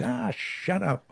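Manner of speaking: high, drawn-out mock yell, held back in volume; softened, high-pitched imitation of a shout; retold shout. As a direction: imitate a woman yelling, without full force. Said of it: "shouted at the top of her voice".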